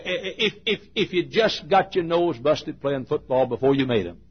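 ordinary talk

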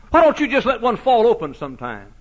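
high, loud preacher's cry; fiery preaching. Acted. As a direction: hammer it home from the pulpit.

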